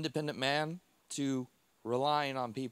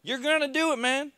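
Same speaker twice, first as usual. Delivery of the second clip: much higher, loud encouraging yell; yelling back; 10 semitones higher, 6 dB louder than usual. Retold or imitated. Staff shouting encouragement at the struggling patient, high and loud. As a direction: push him on, shouting. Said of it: "they yell back at me".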